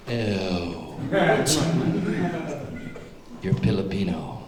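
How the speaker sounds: low, sneering mock disgust; hammed-up revulsion at the applicant. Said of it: "where you go".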